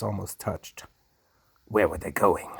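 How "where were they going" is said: ordinary delivery, nothing marked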